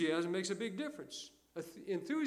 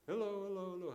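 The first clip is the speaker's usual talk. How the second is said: higher, hushed repeats dying off; imitated echo; 4 semitones higher, 3 dB quieter, faster than usual. Preacher playing the canyon's reply, fading echo. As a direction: bounce it back like an echo. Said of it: "the echo comes back".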